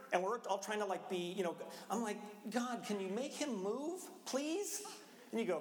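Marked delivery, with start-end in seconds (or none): 2.5-4.8 exasperated prayer. Retold or imitated. Impatient prayer about Starbucks talker.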